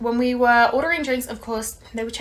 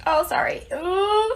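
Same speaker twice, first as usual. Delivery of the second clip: higher embarrassed apology then wince; sheepish apology; 8 semitones higher, slower than usual. Retold or imitated. Manager apologizing after the mistake, sheepish and higher. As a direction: sound caught out. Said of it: "the manager had to be like".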